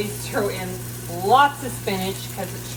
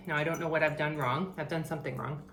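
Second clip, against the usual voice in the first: usual speaking voice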